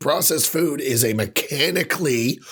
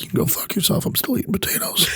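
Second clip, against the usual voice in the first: unvoiced whispered defiance, higher; mock whisper; 8 semitones higher, faster than usual. Retort to diet rules, whispered and mocking. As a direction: hiss it back defiantly.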